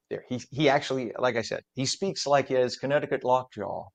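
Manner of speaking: normal talking voice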